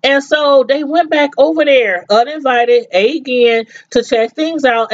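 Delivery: ordinary talk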